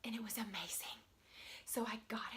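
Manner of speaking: whispered, awed, like telling a secret. Acted. Telling viewers about her amazing secret find in a whisper. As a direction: hush it like a treasure. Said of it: "hushed secret find".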